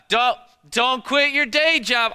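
a hint of a snide put-down, slow; lightly hammed-up sneer at the waiter; rude snark. Preacher mocking himself being rude to a waiter, light sneer. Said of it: "I'm not good at this".

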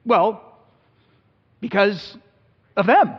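mock petulant blame; childish blaming. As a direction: whine the excuse like a child. Inner dialogue, angry self.

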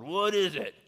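a father wheeling round on his son, armed and annoyed; sharp defensive snap. Retold or imitated. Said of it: "I turned around with my sword".

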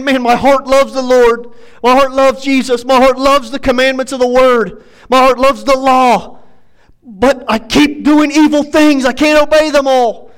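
frustrated with himself, high and loud; Paul. Retold or imitated. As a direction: preach out his exasperated confession. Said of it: "He's frustrated with himself".

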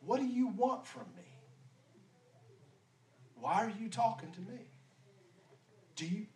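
guarded, keeping her distance; suspicious. Acted. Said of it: "she might be a little bit suspicious".

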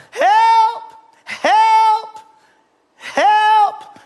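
high, loud, long-held yell; crying out for help. Acted. Falling man hanging from a branch cries out, high holler. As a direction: holler desperately from a cliff edge. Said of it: "he cried out".

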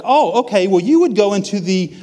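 high, helpful greeter voice; imitated church welcomer; chirpy church greeter. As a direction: pitch it up, politely giving directions. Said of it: "they're like".

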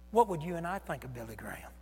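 ordinary delivery

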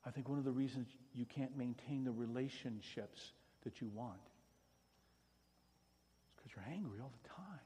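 usual speaking voice

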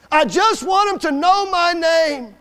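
slow, loud, high-pitched cry; anguished plea. Retold or imitated. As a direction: wail out the boy's plea, drawing out each word.

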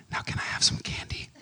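unvoiced whisper, holding back a laugh. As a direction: whisper it sneakily for candy. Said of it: "can I whisper in your ear".

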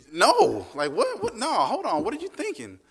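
husband in comic disbelief at his wife; mock disbelief. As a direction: squeak out baffled objection. Husband protesting wife's offer.

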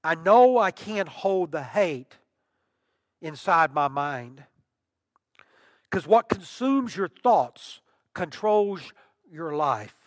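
slow, solemn recital of song words; reciting lyrics. Shown as a demonstration. Preacher quoting a rock song, slow solemn recital. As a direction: read the lyrics slowly and weightily. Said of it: "the words of the song".

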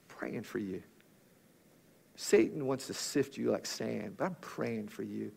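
very soft, low, tender and patient; Jesus, kind and patient. Acted. Preacher as Jesus calming Peter before his denial, kindly and patient. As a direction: gently hush someone you care for, voice dropped low. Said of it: "Jesus so kindly and patiently says".

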